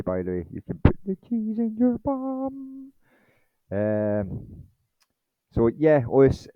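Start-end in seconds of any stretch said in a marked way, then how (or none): 0.5-2.9 very high, slow, squeaky silly voice; cult movie quote voice. Retold or imitated. Mocking a UFO insider with a silly high movie-quote voice. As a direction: stretch it out in a goofy high squeal. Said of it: "that's from a f-, a, a famous cult movie".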